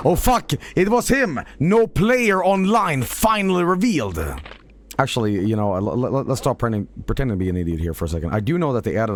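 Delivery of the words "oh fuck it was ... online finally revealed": high, over-the-top streamer yelp; hammed-up shocked outburst, pitched way up; mock excitement. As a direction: shout it like a huge silly revelation. Pretending to be an idiot.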